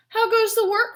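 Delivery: loud, high farmer's call. Acted. Farmer calling out to duck.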